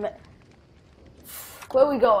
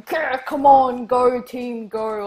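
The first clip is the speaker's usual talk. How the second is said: mock soccer crowd cheer, loud growl falling away; growl, then cheer the team on in drawn-out shouts; imitated shouting; 2 semitones higher, 6 dB louder, slower than usual. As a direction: imitate rowdy sideline shouting, easing off by the end. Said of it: "they're pretty much just shouting".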